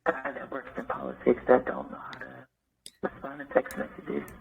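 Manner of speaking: soft sensual morning voice. Real, not acted. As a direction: talk in a just-woke-up morning voice. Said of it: "I got my morning voice on, so it's gonna sound a little sensual".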